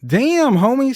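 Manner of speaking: high, impressed "damn, homie"; impressed exclamation. Retold or imitated. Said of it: "and I'm like".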